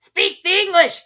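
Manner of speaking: very high, loud, slow bird voice; cheeky Eaglet. Acted. Eaglet mocking the Dodo, high and loud. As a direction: demand it cheekily. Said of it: "said the Eaglet".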